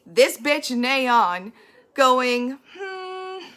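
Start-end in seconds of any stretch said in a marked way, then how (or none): 2.8-3.5 mock sneering hum. Mean classmate sneers at boy.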